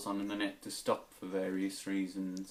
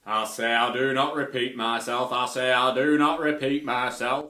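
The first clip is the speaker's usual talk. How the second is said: louder, higher put-on voice; character impression; 3 semitones higher, 9 dB louder than usual. Impressions video, a loud higher put-on catchphrase. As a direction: insist you never repeat yourself in character. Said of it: "do some impressions".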